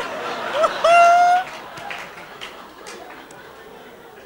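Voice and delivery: shrieking high-pitched cackle of delight; long high rising laugh; high giddy laugh. Retold or imitated. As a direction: let out a scandalised squeal of laughter.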